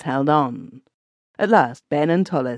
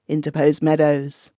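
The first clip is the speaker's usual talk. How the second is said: ordinary delivery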